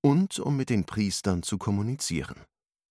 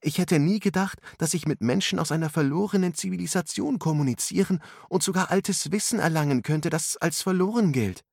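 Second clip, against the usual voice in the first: put-on much higher, amazed girl's cry; excited exclamation; 10 semitones higher than usual. A narrator's reading. Angela marvels at the lesson with her friends, excited. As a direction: burst out in wonder. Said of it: "rief Angela aus".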